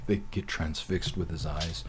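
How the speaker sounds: normal talking voice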